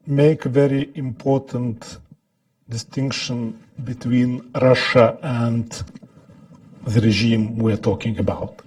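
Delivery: English with a Lithuanian accent. Real, not acted. Lithuanian speaker giving a talk.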